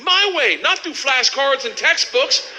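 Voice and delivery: high-pitched, excited shouting; elated boasting. Acted. Student thrilled to understand Russian, elated high shout. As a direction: crow triumphantly way up high. Acts his drunk elation, shouting.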